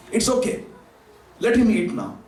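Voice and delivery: usual speaking voice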